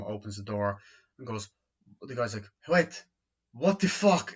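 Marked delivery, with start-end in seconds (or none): 2.7-4.3 high, loud startled outburst; shocked what the fuck. Retold or imitated. Guy finding her at his door, startled loud outburst.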